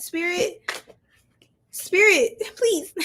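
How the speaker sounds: high-pitched plea breaking into laughter; amused, exasperated high plea; playful pleading. Real, not acted. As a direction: beg in a high voice, then crack up.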